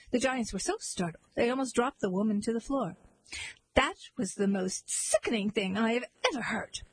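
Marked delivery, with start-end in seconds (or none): none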